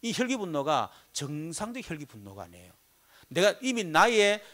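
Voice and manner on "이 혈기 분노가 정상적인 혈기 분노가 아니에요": usual speaking voice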